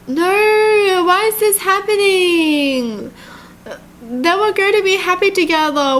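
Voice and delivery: high, drawn-out crying whine; high, tearful pleading; distraught. Real, not acted. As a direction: wail in protest at the sad turn. Distraught reaction to the dying character.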